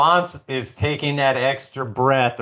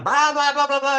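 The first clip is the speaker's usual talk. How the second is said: high-pitched imitation of nagging yells; mock ranting; 12 semitones higher, faster than usual. Husband imitating his wife getting mad, mock ranting. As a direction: babble a pretend tirade, pitched way up. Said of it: "she'd yell at me or get mad".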